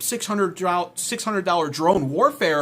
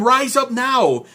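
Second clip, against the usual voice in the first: slow, loud mock command; mock Trump rally call; 9 semitones higher, 7 dB louder, slower than usual. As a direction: drag out the call to rise. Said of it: "He tweeted at them and he said".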